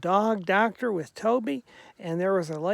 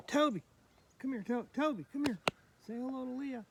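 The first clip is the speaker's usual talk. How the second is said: high, soft dog-calling voice; gentle, lifted coaxing; coaxing a pet; 6 semitones higher, 6 dB quieter than usual. Real, not acted. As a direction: sweet-talk the dog into coming over. Calling his dog over.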